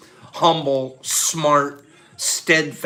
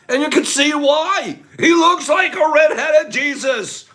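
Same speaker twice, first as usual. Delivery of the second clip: excited shout; 12 semitones higher than usual. Real, not acted. Host laughing about Jeff's looks, excited shout. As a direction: shout it out with glee.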